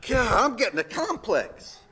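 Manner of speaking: ordinary talk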